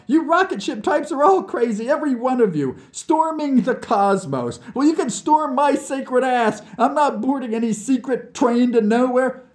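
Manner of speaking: high, loud, overwrought rant; tearful outburst. Acted. Nikita refusing to board a train to Siberia, sobbing with anger. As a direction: protest wildly, on the edge of tears. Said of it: "I sobbed".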